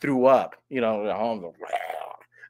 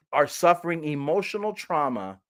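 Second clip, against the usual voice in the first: ordinary talk, nothing marked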